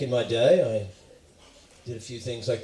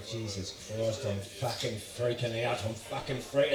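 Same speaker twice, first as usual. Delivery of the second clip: panicky Australian; 5 dB quieter than usual. Retold or imitated. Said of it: "when you're Australian, you can go".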